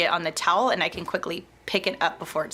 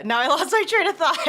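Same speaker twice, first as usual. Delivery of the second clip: laughing; 8 semitones higher than usual. Real, not acted. Laughing after slip.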